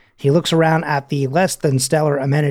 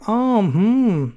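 a higher, bored hum to stop a chat; bored hmm; 5 semitones higher, much slower than usual. Retold or imitated. Listener stuck in a chat, bored higher hum. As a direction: brush off a talker with a hum. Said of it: "keeps talking, like".

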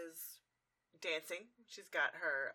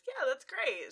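approving gentleman; 8 semitones higher, 5 dB louder, slower than usual. Retold or imitated. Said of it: "when Fancy Pants goes".

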